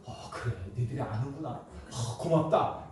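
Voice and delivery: soft moved reply. Retold or imitated. Father quietly thanking his children, softly moved. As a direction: answer your kids gently, touched.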